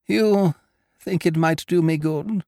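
high, tentative lady's question; wavering. A narrator's reading. Hypochondriac asking Ukridge about the tonic, wavering and high. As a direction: ask hopefully, half persuaded.

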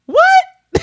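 high, loud, laughing surprise; shocked what. Retold or imitated. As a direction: squeal it out in disbelief. Retold shocked reply, laughing.

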